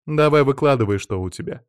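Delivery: impatient, loud at the start then dropping; demanding. A narrator's reading. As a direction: demand that he get to the point. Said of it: "потребовала женщина". (требовательно)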